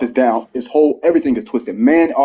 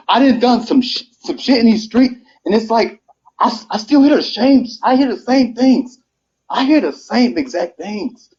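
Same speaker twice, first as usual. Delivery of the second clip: heated outburst; 9 semitones higher than usual. Real, not acted. A man venting to the panel that nothing changes, heated and higher. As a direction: voice climbing as the frustration boils over.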